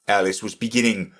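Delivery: plain reading voice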